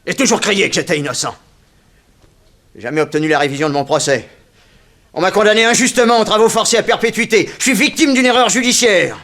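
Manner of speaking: loud, rising, furious protest; indignant outburst. Acted. Freed convict shouting his innocence at the prison director, furious.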